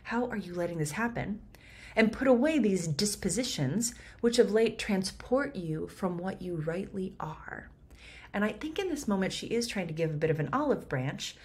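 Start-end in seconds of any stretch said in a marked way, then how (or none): 2.0-7.7 measured verse reading; Shakespeare reading. Shown as a demonstration. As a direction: read it as stage verse, a touch raised. Reads the verse.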